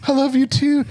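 high-pitched weepy declaration; hammed-up heartfelt sobby voice; mock tearful. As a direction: squeak it out as if crying. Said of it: "He's crying".

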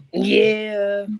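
loud, high raspy "Yeah" yelled like a rap ad-lib; signature yeah ad-lib. Shown as a demonstration. Rapper repeats his trademark ad-lib for the people. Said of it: "I hit that one more time for the people".

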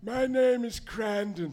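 put-on slow, stately old man introduction; old wizard Crandon. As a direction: announce himself grandly and slowly. GM voices Crandon.

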